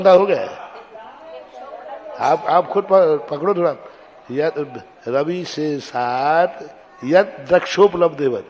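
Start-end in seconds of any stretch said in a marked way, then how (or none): 4.3-8.4 slow chant, यद with a held double द; slow teacher's recitation; slow demo recitation. Shown as a demonstration. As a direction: model the verse slowly for pupils. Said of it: "तो दो दा हो जाएंगे".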